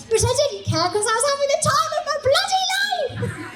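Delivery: high, loud joyful yell with a laugh; euphoric shout. Acted. [name] telling the audience about Glastonbury, joyful shout. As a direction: shout it out to the crowd.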